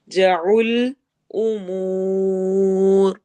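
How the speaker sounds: extremely slow drawn-out chant; Quranic recitation. Shown as a demonstration. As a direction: stretch the syllables out long. Teacher recites Quranic verse.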